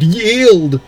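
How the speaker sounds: high, loud yelp of surrender; desperate scream. Acted. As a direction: scream it out, beaten and begging. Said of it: "as he screamed".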